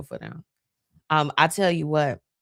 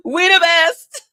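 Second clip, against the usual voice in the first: high, loud, laughing We the best; 10 dB louder, slower than usual. Real, not acted. Hyping fellow Tauruses, laughing loud and high. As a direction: crack up as you say it.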